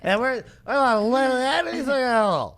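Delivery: a hint of mocking impression; mocking her words. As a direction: parrot the clip drunk-style. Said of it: "Listen to this".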